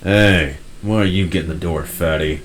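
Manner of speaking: deep, brassy character voice; Flynn. Acted. Flynn ribs his friend at the door, deep and brassy. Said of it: "deep, brassy voice of Flynn".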